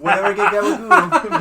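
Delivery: loud, laughing mock Jersey accent. Friends joking about Sopranos, laughing mock Jersey accent. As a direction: ham up a Sopranos-style Jersey voice. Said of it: "I still love a good Jersey accent".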